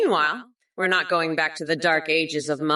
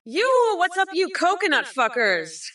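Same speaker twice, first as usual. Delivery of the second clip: sudden yell; 9 semitones higher than usual. Acted. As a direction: burst out at the viewers. Yelling at chat viewers.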